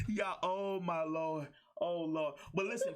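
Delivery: usual speaking voice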